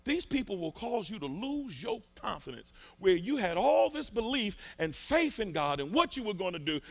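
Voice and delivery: usual speaking voice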